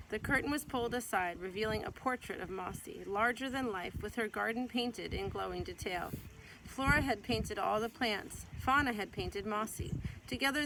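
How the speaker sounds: gentle, lively read-aloud voice; storybook reading. Acted. Mother reading a picture book to her child, gentle storytelling.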